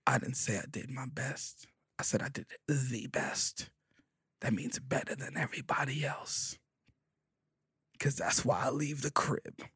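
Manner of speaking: soft, near-whispered correction; quiet insistence. Retold or imitated. Correcting his homegirl by message, quiet and firm. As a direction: hush it but mean it.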